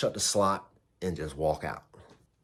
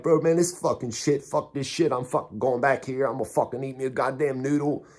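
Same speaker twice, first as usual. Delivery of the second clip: higher pitched, cocky angry rant; replaying his fed-up outburst at the lieutenant, higher and heated; retold attitude; 6 semitones higher than usual. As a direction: vent it like a fed-up young inmate. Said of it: "I already got my attitude".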